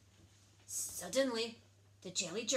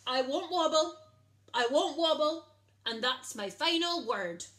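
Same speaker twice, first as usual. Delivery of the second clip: much louder, higher stubborn scream; jelly screaming its refusal; 6 semitones higher, 10 dB louder than usual. A narrator's reading. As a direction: yell it defiantly. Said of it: "screamed the jelly".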